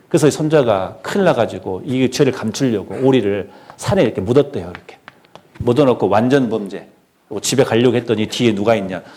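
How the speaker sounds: ordinary talk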